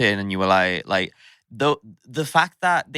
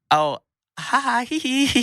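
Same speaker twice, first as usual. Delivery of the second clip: high, squeaky mock-nervous titter; scared nervous giggle; 12 semitones higher than usual. Recalling being scared on arrival, high nervous fake giggle. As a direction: imitate your own terrified giggle, very high. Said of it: "I was so scared to be there".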